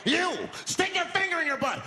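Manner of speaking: high, loud creepy order; high mock demand. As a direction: demand it with relish. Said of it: "making demands".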